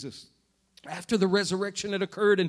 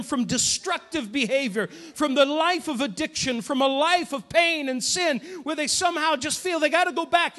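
rising, louder, higher preacher's build; preaching at full force; 6 semitones higher, 5 dB louder than usual. Acted. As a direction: drive the altar call home, higher and higher. Preaching climax on deliverance.

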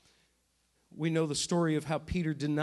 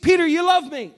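much higher and louder, pressing him; insistent question; 9 semitones higher, 11 dB louder than usual. Retold or imitated. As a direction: demand an answer. Jesus asking again, more insistent.

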